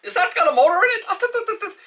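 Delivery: very high, excited mock gibberish-speaker voice; imitated foreign driver. Oblivious foreign driver marveling at the plane, high comic imitation. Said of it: "whatever language he was speaking".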